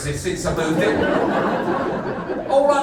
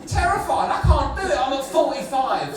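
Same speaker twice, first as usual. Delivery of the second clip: high, loud frightened protest; comic panic; 8 semitones higher, 5 dB louder than usual. Acted. Comic driver terrified at forty-five on narrow lanes, panicked. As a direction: plead at high pitch, hammed-up fear.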